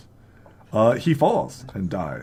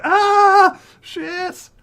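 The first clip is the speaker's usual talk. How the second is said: sudden much higher yelp, then cut off; startled cry; 11 dB louder than usual. Acted. GM voicing a startled bandit, high cry. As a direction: cry out in alarm. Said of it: "He cries out like".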